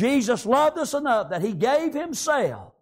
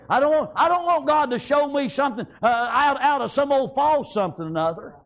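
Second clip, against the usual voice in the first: raised, emphatic pulpit delivery; fervent preaching; 4 semitones higher than usual. Real, not acted. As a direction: preach it with force, pitched up. Preaching at full force.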